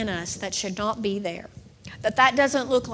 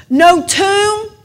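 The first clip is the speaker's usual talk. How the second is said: loud preaching cry; 7 semitones higher, 8 dB louder than usual. Acted. Preaching contrasts.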